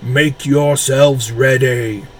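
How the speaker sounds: slow, commanding army order; booming commander voice. Acted. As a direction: drive the soldiers to move. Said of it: "the voice boomed".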